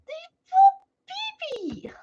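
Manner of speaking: very high, slow cooing; high drawn-out baby coo; baby talk. Pretend. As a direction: coo like talking to an infant. Cooing at viewers.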